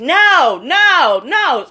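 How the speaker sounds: loud, high, repeated mock no; mock insistent refusal. Recapper mimicking wife refusing to sit, loud mock protest. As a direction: belt out each no in imitation.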